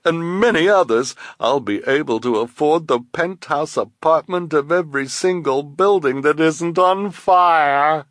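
high, loud theatrical villain voice; high-pitched gloating story villain; gloating schemer. Acted. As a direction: crow over the scheme, stretching the last words.

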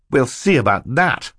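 put-on defiant child. Violet answers her captor back, defiant. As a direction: answer back boldly as a girl.

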